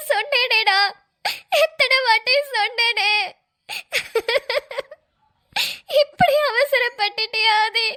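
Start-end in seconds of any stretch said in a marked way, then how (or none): 1.6-7.9 slow, weeping reproach; crying. Acted. Bride crying at Aadhi for rushing the marriage. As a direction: drag it out through sobs.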